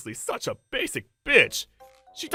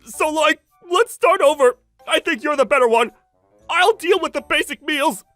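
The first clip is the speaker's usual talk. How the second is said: wheedling plea; 3 semitones higher, 11 dB louder than usual. Acted. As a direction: talk an ex into taking you back. Ex-husband begs to start over.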